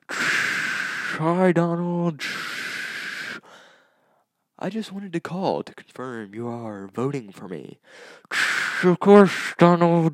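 high, loud and distant, slow; shouting from the shower over the water; from another room. Shown as a demonstration. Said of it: "let me demonstrate what it would sound like".

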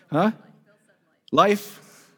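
ordinary talk, nothing marked

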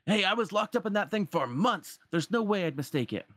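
high, insistent parrot voice; indignant Iago. Acted. Iago insisting he knows that lamp, indignant.

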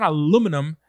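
"aluminum" stressed on LU; American pronunciation. Shown as a demonstration. Objecting to US usage, "aluminum" stressed on second syllable. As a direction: say "aluminum" as a-LOO-mi-num. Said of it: "The emphasis is in the wrong syllable".

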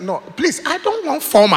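high, loud protest; blunt refusal. Retold or imitated. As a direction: refuse outright, acting out an irritated friend. Acted blunt refusal to visitors.

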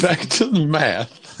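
mock dramatic echo. Echoing friend in a put-on voice.

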